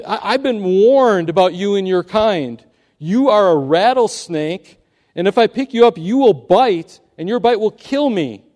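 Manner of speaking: loud, slowed, emphatic warning; firm refusal. Acted. Youth refusing the rattlesnake, loud firm warning. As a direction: stand your ground against the snake. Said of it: "said the youth".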